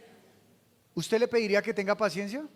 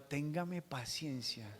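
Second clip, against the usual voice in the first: soft plea; 5 semitones lower, 11 dB quieter, slower than usual. Shown as a demonstration. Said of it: "Dígale al de al lado".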